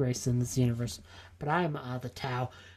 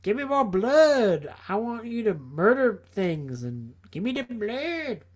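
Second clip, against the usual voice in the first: slow mock-menacing demand, pitched up; Khorne; 4 semitones higher than usual. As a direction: demand it like a hammed-up war god. Voices blood god Khorne demanding blood.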